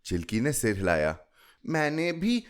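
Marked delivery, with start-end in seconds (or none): 1.6-2.4 put-on high little girl's voice; a child owning up, pitched high; small child. A narrator's reading. Chilki admits she didn't listen to mama, high child voice.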